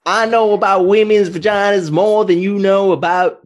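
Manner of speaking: high mock Southern accent; pompous put-on Southerner. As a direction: boast in a hammed-up Southern accent. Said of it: "Can you do the Southern accent?".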